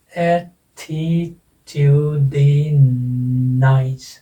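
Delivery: "attitudinous" very slow, each syllable held separately. Shown as a demonstration. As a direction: stretch "attitudinous" out syllable by syllable with pauses. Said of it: "कितने syllable हैं?".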